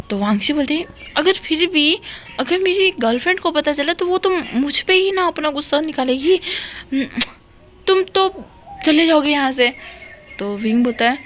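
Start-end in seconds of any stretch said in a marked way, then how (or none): 1.2-9.7 rising nervous complaint; anxious friend. Acted. Wangshi worries her girlfriend will take it out on her, anxious.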